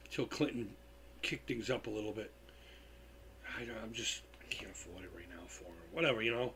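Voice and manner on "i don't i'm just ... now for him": father whispering, embarrassed; ashamed whisper. Retold or imitated. Father admitting he can't afford guitar, whispered. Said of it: "the father spoke up and said".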